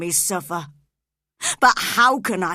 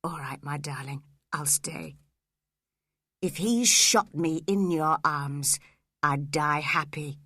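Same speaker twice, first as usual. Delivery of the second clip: tender whisper; 3 semitones lower than usual. A narrator's reading. Heathcliff whispering comfort to Catherine, tender. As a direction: hush her gently.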